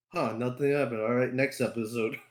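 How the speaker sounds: normal talking voice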